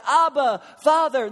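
high, loud cry to the father; pleading shout; desperate cry. Retold or imitated. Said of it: "This is a scream of desperation".

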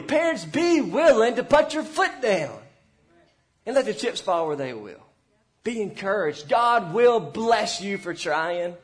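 rising, fervent pulpit exhortation; preaching. Acted. Pastor urging struggling parents from the pulpit, preaching hard. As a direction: urge them on from the pulpit, building force.

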